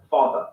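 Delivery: father ending in a simple schwa; non-rhotic ending on father; British schwa ending. Shown as a demonstration. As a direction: say father with no final R, British style. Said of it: "ends in a simple schwa vowel".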